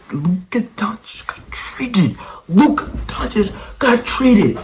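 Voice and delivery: high, strained cry of disbelief; high shocked disbelief. Real, not acted. To viewers, repeating the trade news in high disbelief. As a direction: repeat it like you can't believe it.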